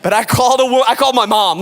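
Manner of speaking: normal talking voice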